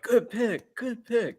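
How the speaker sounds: high-pitched, soft sportscaster impression; Corso voice. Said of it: "I'm not gonna do the Corso voice".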